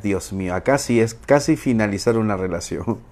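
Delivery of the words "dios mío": ordinary talk, nothing marked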